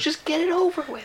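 high, pleading with a sigh; exasperated plea. Acted. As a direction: beg for the end. Dramatic plea climax.

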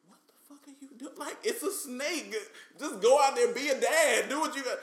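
very high, loud, disbelieving rant; exasperated kid rant. Retold or imitated. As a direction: scold dad for taking forever with the gun. Retold rant at dad.

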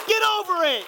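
high, full-force exasperated yell; impassioned shout. Acted. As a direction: holler the lesson home. Preacher shouts the lesson after story.

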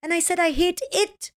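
whiny daughter. Retold or imitated. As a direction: drag it out like an exasperated kid. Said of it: "She's like".

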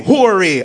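mock the misreading, leaning on H in "Hori"; added H. Comedian mocking shortened name, "Hori" with added H. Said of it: "There's no H at the beginning of my name".

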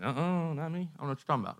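lightly acted "who, me?" denial; mock innocent denial. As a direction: play dumb and brush off the accusation. Peter denies knowing Jesus, acted.

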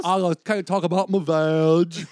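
slow mock drawl; Forrest Gump. Friend imitating the comic's bad accent, slow drawl. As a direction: stretch each word like a simpleton. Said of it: "that was exactly like".

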